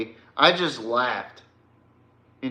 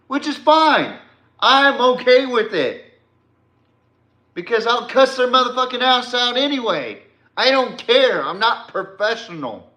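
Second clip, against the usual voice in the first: higher-pitched, heated dismissal; defiant rant; 9 semitones higher, 3 dB louder than usual. Real, not acted. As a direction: insist loudly that you don't care. Heated defiant rant at online rival.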